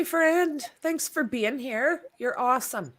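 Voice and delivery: normal talking voice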